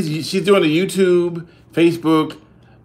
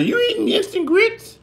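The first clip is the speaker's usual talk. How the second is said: squeaky mock voice. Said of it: "some people say".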